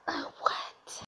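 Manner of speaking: breathless whispered what; too shocked to speak aloud; stunned whisper. Real, not acted. Stunned by Victor's demand.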